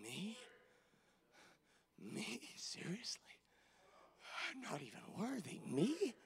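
very soft, halting, with long pauses; hushed disbelief. Acted. Preacher marveling he is not even worthy, hushed disbelief. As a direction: barely voice it, humbled and incredulous. Humbled disbelief at God caring for him.

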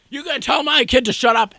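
high-pitched imitation of an irate dad; hammed-up yelling parent; mock angry yell. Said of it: "he must be yelling at someone".

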